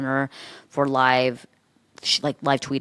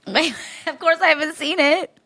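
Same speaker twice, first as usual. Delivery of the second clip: laughing; 14 semitones higher, 3 dB louder, slower than usual. Real, not acted. Laughing reply.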